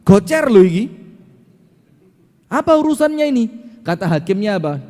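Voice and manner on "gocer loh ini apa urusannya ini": high, loud outraged protest; angry father. Retold or imitated. Bleeding father protesting to the judge, angry. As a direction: act out an indignant father, pitch shooting up. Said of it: "Terus bapaknya ngamuk".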